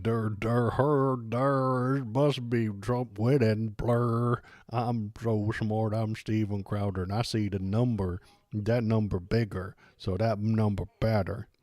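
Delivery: dopey, slurred mock of Steven Crowder; mocking dumb voice. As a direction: play him as a dimwitted blowhard. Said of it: "let me do his voice".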